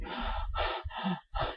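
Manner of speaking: demonstrated gasp for air; big, audible breaths, as if gasping; deep gasping breath. Shown as a demonstration. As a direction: breathe deeply and noisily to demonstrate. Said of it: "that happens during deep breathing".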